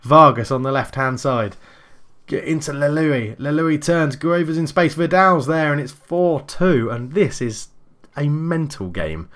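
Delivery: brisk running match commentary; play-by-play commentary. Acted. Live football game commentary.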